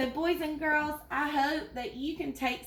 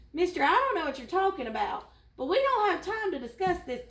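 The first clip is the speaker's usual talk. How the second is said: raised, high-pitched gazelle voice, mildly shouted; exasperated shouting; 7 semitones higher, 3 dB louder, faster than usual. Acted. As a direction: cut him off urgently in a higher voice. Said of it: "the gazelle shouted".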